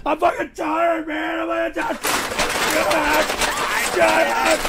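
sobbing, wounded cursing; whiny, tearful outburst; crying rant. Real, not acted. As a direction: curse through tears in short bursts. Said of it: "stop fake crying for sympathy".

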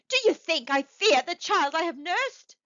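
high, loud indignant question; impassioned cry. A narrator's reading. Mother scorning fear of her child, impassioned cry. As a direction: throw the question out passionately. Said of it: "cried the old woman".

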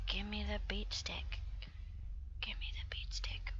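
hushed, voiceless plea; whispered demand. Acted. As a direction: whisper it insistently. Whispered demand after loud one.

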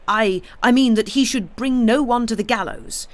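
high, stammering, feverish insistence; febrile agitation. A narrator's reading. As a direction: stumble over the words in agitation. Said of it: "febrile and feminine agitation".

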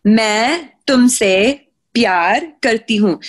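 fast fluent Hindi: "मैं तुमसे प्यार करती हूँ"; Hindi phrase. Shown as a demonstration. To a friend asking how it's said, fast fluent Hindi. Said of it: "How do you say it?".

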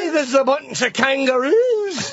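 high, slow cartoon Australian accent; mock Aussie accent. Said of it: "You want some more Aussie accents".

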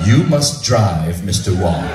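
imitating a ponderous director, long pauses; unhurried, deliberate verdict; very slow, calm. Director orders Bob to drive, very slowly. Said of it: "this director was speaking was so slow".

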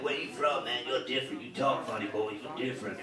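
mock the Southern local asking where he's from; American locals.

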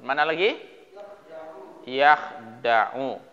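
ordinary talk

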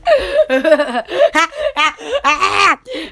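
breathy burst of laughter; laughing fit. Real, not acted. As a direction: break up laughing. Amused by own bit.

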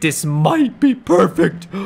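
slow, drawn-out delighted repeat; slow savouring. Pretend. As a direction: stretch perfect out slowly, dreamy. Repeated, stretched out.

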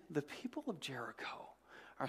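hushed storytelling whisper. Acted. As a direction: lean in and whisper it. Preacher dramatizes gossip in Jericho.

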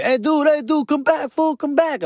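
high-pitched call after someone; acted call to someone walking off. Dad calling the departing fan back, high calling voice.

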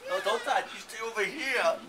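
ordinary talk, nothing marked

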